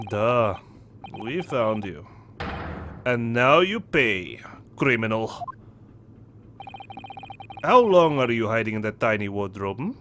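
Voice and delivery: put-on low, deliberate accented interrogator; menacing Russian sailor. Sailor to the captured stowaway, low accented menace.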